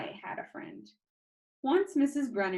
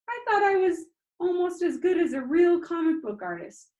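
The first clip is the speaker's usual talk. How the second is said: upset; 9 semitones higher, 7 dB louder than usual. Acted. Upset about failing eyesight.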